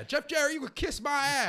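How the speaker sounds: loud, high-pitched acted taunt; Jackie Fargo. Retelling a wrestler's insult to Jeff Jarrett, loud mock defiance. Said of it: "Jackie Fargo gets on and says".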